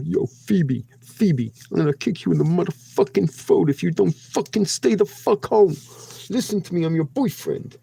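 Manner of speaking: put-on threatening boyfriend. Threatening viewer as her boyfriend.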